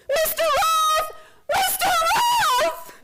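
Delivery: high, drawn-out screaming of his name; terrified scream. Acted. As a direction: scream after him in horror. Man walks into wall.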